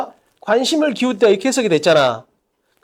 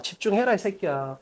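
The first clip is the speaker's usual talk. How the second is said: quiet, low scolding aside; muttered scolding; 4 semitones lower, 10 dB quieter than usual. Real, not acted. Teacher telling a student to concentrate, muttered scolding. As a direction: scold under your breath, dropping away.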